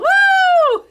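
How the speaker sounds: high, loud whoop of joy; loud woo. Real, not acted. Host whooping to celebrate prizes for viewers, loud cheer. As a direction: cheer like a crowd.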